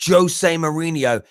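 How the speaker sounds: ordinary delivery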